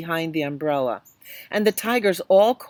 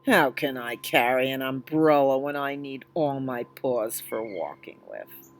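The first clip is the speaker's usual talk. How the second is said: tiger; 5 semitones lower than usual. Acted. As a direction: rumble it out, unimpressed. Second tiger objects.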